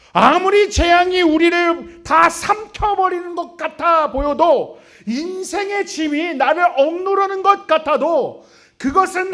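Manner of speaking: fiery preaching. Acted. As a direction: preach it out like thundering from the pulpit. Opens with loud fiery preaching. (높고 크게 천천히 외치는 설교)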